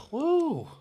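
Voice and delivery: high, sudden alarmed yelp of waking; startled panic. Retold or imitated. Trucker retelling being woken up, alarmed and high. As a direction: yelp it like you were jolted awake. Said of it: "I'm like".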